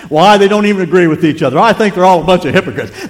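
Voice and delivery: loud, high imitation of indignation; hammed-up grumbling at full volume; mock objector. Churchgoer making excuses about churches, mock indignation. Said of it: "they'll say".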